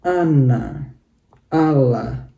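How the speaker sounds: ana and ala stressed on the first syllable; word demo. Shown as a demonstration. Teacher repeating examples with first-syllable stress. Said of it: "naik di suku kata yang pertama".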